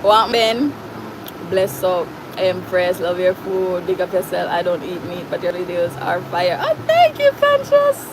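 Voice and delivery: ordinary talk, nothing marked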